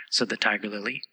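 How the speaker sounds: usual speaking voice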